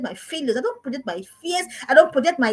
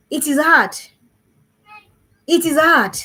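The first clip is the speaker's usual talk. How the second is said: emphatic, louder; 4 semitones higher, 7 dB louder, slower than usual. Real, not acted. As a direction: press the line out with weight. Repeats hard truth.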